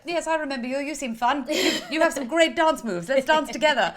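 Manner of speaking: high, loud, laughing enthusiasm; hammed-up friendly gush; gushing re-enactment. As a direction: play up an eager new fan. Acts out gushing first meeting.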